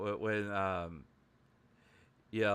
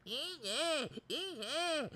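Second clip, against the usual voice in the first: very high, soft squeaks; high little mimicked squeals; Miko; 14 semitones higher, 5 dB quieter than usual. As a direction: squeak in a tiny put-on voice. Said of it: "she's like".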